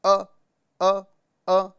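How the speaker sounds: throat stop ا, short and separate; hamza sound. Shown as a demonstration. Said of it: "حمزہ کی آواز جو ہے وہ نیچے والے حلق سے".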